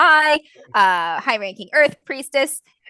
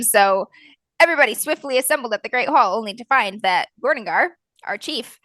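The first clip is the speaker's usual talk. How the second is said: usual speaking voice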